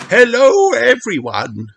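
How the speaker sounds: high, loud welcoming teacher; Madame Gazelle. A narrator's reading. Madame Gazelle welcomes the family at her door, graciously. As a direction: greet them graciously. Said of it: "says Madame Gazelle".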